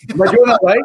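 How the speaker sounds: goofy, seeking approval; playful high check-in. Real, not acted. Guest checks with hosts, laughing.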